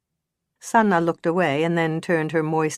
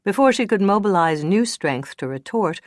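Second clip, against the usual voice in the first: ordinary delivery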